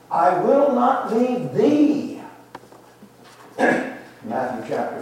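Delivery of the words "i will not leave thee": high, slow, emphatic line landing on a long "thee"; stress on THEE. Shown as a demonstration. Preacher driving home Elisha staying with Elijah, stress on "thee". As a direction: proclaim it slowly, hitting "thee" hard at the end. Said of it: "now we emphasize the last word".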